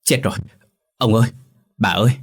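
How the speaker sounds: mildly alarmed calling out; la toáng. A narrator's reading. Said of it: "la toáng lên". (giọng hớt hải kêu la)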